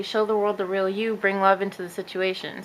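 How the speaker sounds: ordinary talk, nothing marked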